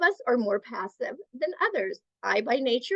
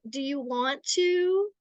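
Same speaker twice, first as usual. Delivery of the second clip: higher, drawn-out question, rising at the end; passive tone; 5 semitones higher than usual. Shown as a demonstration. Adult asking a child's wishes, passive questioning tone. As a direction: leave it hanging as a question. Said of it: "it sounds like a question".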